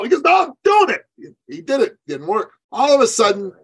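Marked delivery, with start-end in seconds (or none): 0.2-1.0 high, loud mock shout; stubborn shout. Client shouting down his coach, high and loud. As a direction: dig in and shout it.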